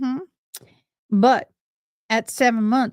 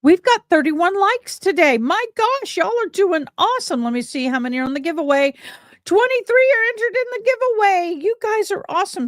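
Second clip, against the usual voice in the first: excited; 8 semitones higher than usual. Real, not acted. Cheering viewers on livestream.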